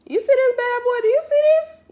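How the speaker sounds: high, loud, gushing over a big bottle; squealing with delight at a giant water bottle; excited show-off. Real, not acted. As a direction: show it off like a prize.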